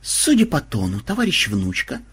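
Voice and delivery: mildly accusing, rising at the end; understated suspicion; stern questioning. A narrator's reading. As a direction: question like a strict superior. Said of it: "строго спросил он".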